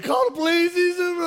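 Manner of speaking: high-pitched mock scream for help; hammed-up panicked shriek, very high; imitated screaming. Beaten man yelling for police, mock screaming. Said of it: "and the guy screaming".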